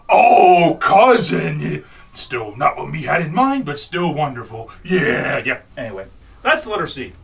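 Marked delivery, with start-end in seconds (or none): 0.1-1.8 loud, delighted put-on voice; mock delight. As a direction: gush it out loud as her. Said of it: "She goes".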